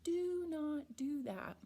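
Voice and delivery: slow, every word spelled out; slow full form. Shown as a demonstration. Teacher acting out the slow full form, drawn out. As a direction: do not spoken as two clear words. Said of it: "We don't slow down and say".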